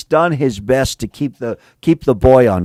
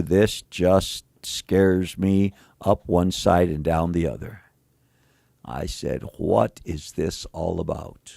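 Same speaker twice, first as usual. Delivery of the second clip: hushed and earnest; 5 semitones lower, 6 dB quieter than usual. Real, not acted. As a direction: lower the voice as if truly afraid. Sermon turns hushed.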